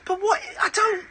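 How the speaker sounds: high, strained disbelief; lost for words, squeaking; incredulous. Real, not acted. Host stunned by food pile.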